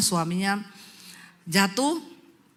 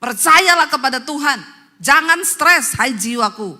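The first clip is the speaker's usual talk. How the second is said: loud, raised exhortation; full-force preaching; 6 semitones higher, 9 dB louder than usual. Acted. Preacher tells congregation to address their souls, forceful preaching. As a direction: command your own soul loudly.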